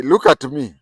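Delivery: sharp, loud command; mock stern demand. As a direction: snap it at him to look. Angry confrontation retold.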